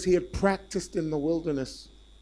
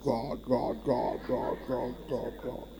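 mock booming god voice; 3 semitones lower, 4 dB louder, slower than usual. Mocking imagined divine voice.

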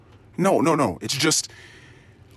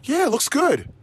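mock reassurance; 13 semitones higher than usual. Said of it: "mock reassurance".

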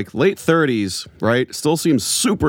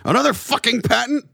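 much higher, exaggerated angry rant; mock furious boss; 11 semitones higher than usual. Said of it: "Was he that mad?".

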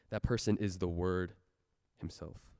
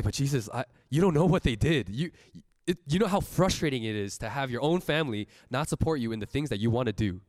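louder, higher pleading complaint; raised voice, upset and pleading; frustrated protest; 6 semitones higher, 7 dB louder than usual. Retold or imitated. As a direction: push back hard, exasperated. Arguing with Jesus in retelling.

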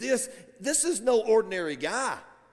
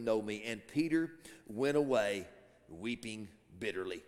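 low, hushed storytelling, fading softer; grave, subdued narration; somber scripture reading; 7 semitones lower, 4 dB quieter than usual. A narrator's reading. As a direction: let the tragedy sink in, dropping almost away.

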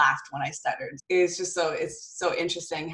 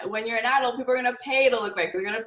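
convince her she is beautiful; emphatic reassurance; 7 semitones higher than usual. Retold or imitated. Mother telling her six-year-old she's beautiful, high and insistent. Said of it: "I had to have that hard conversation".

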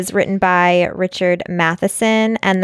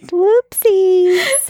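playful high whoopsies; 12 semitones higher, 3 dB louder than usual. Real, not acted. Playful apology.

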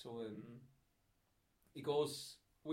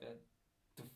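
ordinary delivery

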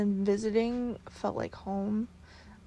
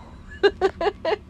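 bursts into a high, loud giggle; giddy laugh; 11 semitones higher, 7 dB louder than usual. Real, not acted. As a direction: laugh it out, a little embarrassed. Giddy over the Scottish accent.

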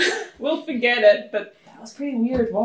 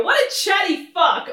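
higher-pitched, sarcastic gripe; mock complaint; 8 semitones higher, 3 dB louder than usual. Player turning from a silent god to friends, mock-exasperated. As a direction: toss it out as a comic grumble. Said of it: "I turn around and go".

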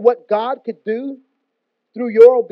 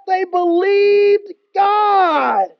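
a drawn-out, very slow shout of "They believed God"; shouted proclamation; 7 semitones higher, 3 dB louder, much slower than usual. Acted. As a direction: proclaim it like the climax of the sermon. Preacher reads Bible line.

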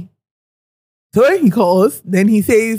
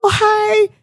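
high, chatty greeting; 12 semitones higher than usual. Retold or imitated. Client greeting the man at the table, high and chatty. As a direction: imitate an overfriendly hello. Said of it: "my client is all chatty".

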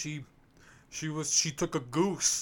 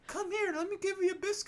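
much higher sing-song put-on voice; mock nanny voice. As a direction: coo it like a fake-sweet English nanny. Mocking English nanny voice.

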